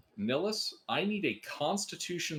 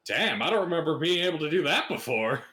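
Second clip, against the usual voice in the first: surprised skeleton; 3 semitones higher, 5 dB louder, faster than usual. Acted. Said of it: "Mazrun looks down at his finger".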